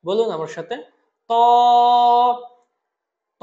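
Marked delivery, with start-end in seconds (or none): 1.3-3.4 ত with its vowel held briefly; one-alif stretch. Shown as a demonstration. Teacher asks students to repeat, ত lengthened. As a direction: draw out ত as one steady syllable. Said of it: "এটাও আমরা এক আলিফ টানবো".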